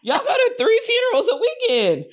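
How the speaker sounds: high-pitched, laughing incredulity; mock disbelief. Daughter reacting to parents' many funerals, laughing mock disbelief. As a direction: call out mom in comic exasperation.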